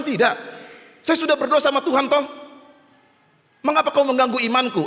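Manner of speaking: high, fast indignant protest; stubborn refusal. Acted. Flood victim refuses rescue team, high stubborn protest. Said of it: "Oh, berkeras dia".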